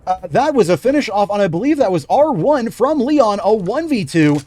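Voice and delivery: energetic esports caster voice; play-by-play commentary. Acted. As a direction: call the round like a live caster. Casting live esports match.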